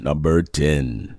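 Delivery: low, slow countdown reveal; mock announcer. Co-host announcing number ten, mock late-show announcer. Announces after drum roll.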